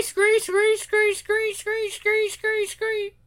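very high monotone screech, fading away; piercing cries tailing off; fading creature screeches. Acted. Screeching continues, dying away.

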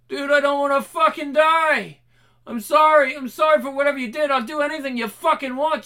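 high, loud terrified pleading; panicked begging. Acted. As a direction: beg for your life in panic. Captive begs for his life.

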